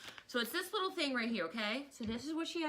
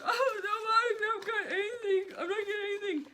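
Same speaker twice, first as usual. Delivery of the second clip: high, louder fake-crying child; crying daughter; 11 semitones higher, 6 dB louder, faster than usual. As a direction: wail like a sad kid who missed out.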